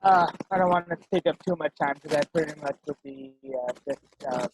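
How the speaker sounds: choppy, muffled connection; garbled like underwater; underwater audio. Real, not acted. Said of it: "Sounds like you're underwater".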